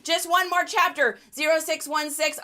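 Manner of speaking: pleading voice. Retold or imitated. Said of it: "I can hear that".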